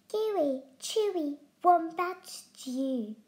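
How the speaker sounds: a touch of boasting; boastful. Acted. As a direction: show off the stew. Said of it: "boasted Dingo".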